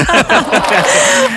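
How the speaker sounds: high bursting laugh; guest laughing. Real, not acted. Guest bursts out laughing at her name. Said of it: "вона вже сміється".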